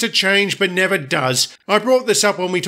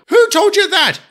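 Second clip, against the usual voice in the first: sharp high shout, much higher and louder; angry demand; 12 semitones higher, 4 dB louder than usual. Acted. Ex-girlfriend confronting Thomas in their quarrel, angry yell. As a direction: fire it back at him, furious. Heated quarrel between ex-lovers.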